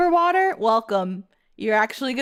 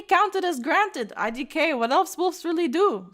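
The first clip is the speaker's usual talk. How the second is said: higher, fast, hammed-up Eastern European accent; mock Eastern European accent, pitched up; bad Eastern European accent; 4 semitones higher, faster than usual. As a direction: read it as a quick, eager Eastern European wolf. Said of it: "doing an Eastern European accent".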